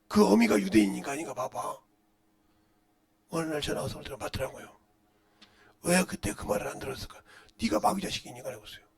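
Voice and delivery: ordinary talk, nothing marked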